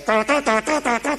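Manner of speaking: sung high siren da da da; siren imitation. Imitating the police siren as he drove by, high.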